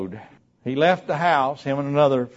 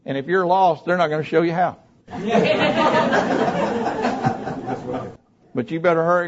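ordinary talk, nothing marked

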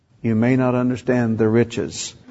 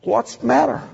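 much higher, sheepish chicken voice; high, hesitant mock cowardice; timid dodge; 11 semitones higher than usual. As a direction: play yourself ducking the question. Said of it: "like any good chicken".

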